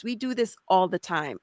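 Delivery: usual speaking voice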